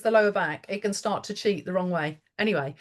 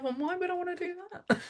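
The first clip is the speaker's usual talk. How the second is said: much higher, softer, amused disbelief; incredulous inner thought; 10 semitones higher, 7 dB quieter than usual. Retold or imitated. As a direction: ask it as a private, bemused aside, high and hushed. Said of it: "I'm thinking to myself".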